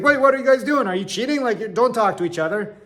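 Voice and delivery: high, loud mock alarm; professor catching cheaters. Professor to two chatting students, high and loud. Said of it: "He's like".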